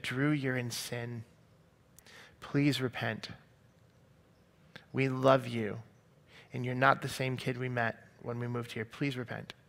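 quiet retold appeal from a caring friend; compassionate. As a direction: plead gently, leaving space between phrases. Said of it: "with the most compassion in her eyes".

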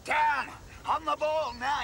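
high, urgent yell down on the ball; shouting from the touchline. Acted. Coach yelling at a player in training, high shout. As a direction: bark the order across the pitch.

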